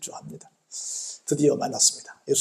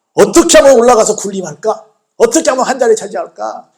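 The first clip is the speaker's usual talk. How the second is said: loud, raised mock scheming; mimicking ambition; 3 semitones higher, 7 dB louder than usual. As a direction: voice their grasping question forcefully. Mimics disciples' ambitious thoughts.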